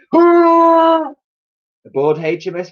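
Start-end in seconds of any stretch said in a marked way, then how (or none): 0.1-1.1 huge trumpeting high cry; deafening mammoth hurrah. Acted. Mammoth coughing out water, deafening hurrah. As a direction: bellow it like a soaked mammoth. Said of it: "The animal spluttered and let out a deafening".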